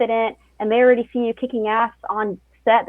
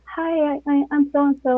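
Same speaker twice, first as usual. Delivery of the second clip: higher, unsure greeting with hesitant stumbles; timid stammer; 6 semitones higher than usual. Retold or imitated. A shy PA greeting a crew member: timid stammer. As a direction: introduce yourself like the nervous newcomer. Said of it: "you kinda get the whole".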